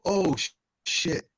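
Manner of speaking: usual speaking voice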